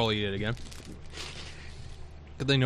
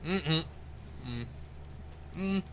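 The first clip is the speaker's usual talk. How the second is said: talking while chewing; mouth full; 3 dB quieter than usual. Real, not acted. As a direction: speak through a mouthful. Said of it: "I'm speaking with my mouth full".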